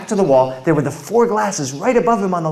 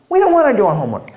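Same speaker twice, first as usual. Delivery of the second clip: put-on whiny kids; 8 semitones higher, 5 dB louder, much faster than usual. Kids refusing homework.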